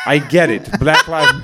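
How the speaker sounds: lively Nepali-accented impression. Comic fed up with hearing it, Nepali accent. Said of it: "do a Nepali accent".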